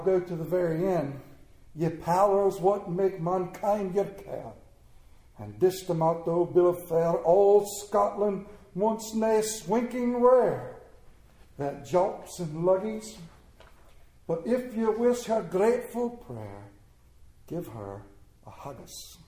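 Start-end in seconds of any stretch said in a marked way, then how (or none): 1.7-16.7 slow mock Scottish brogue reading; hammed-up Scottish accent recital. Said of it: "I'm gonna do it in a Scottish brogue". 17.5-19.2 "give her a haggis" in a low, soft mock Scottish brogue, trailing into a whisper on "a haggis"; put-on Scottish accent, fading to a hush. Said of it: "I'm gonna do it in a Scottish brogue".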